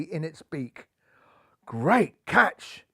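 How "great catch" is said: amazed gasp. Acted. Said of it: "gasped Fluffnell".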